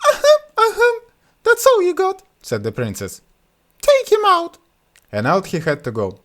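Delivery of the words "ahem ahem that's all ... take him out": very high, loud mocking princess. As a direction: mimic then dismiss. Princess mocks suitor.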